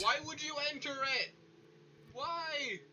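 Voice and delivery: high, fast, off-mic complaint; shouting at the screen from afar; far from mic. Real, not acted. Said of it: "If I sound further away".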